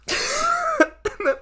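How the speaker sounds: high-pitched giggle; high laugh. Real, not acted. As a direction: burst into a giggle. Laughing at own troll joke.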